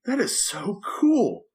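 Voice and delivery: high, slow, drawn-out amazement; awed. Retold or imitated. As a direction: savor it like the coolest thing ever. Father's awed reaction to son's story.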